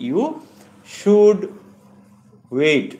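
YOU, SHOULD, WAIT, each punched loudly with long pauses; word by word. Shown as a demonstration. Teacher showing students how should is used, word by word. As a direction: separate "you should wait" into isolated, emphatic words.

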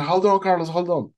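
high urgent call; retold calling out. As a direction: call to a struggling swimmer to hang on. Friend calls out to swimmer.

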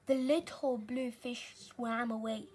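ordinary delivery, nothing marked

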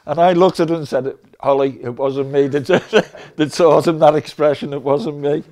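loud, laughing through the words. Real, not acted. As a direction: tell it while still cracking up. Laughing through the end of anecdote.

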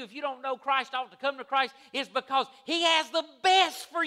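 loud, high-pitched preaching, hitting key words hard; full-force preaching. Real, not acted.

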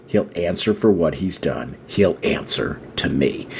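low, slow, menacing warning; grim, no-nonsense low delivery; Bruce. Acted. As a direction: lay down the threat slowly, deep in the voice. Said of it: "there's no messing with him".

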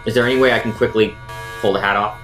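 normal talking voice

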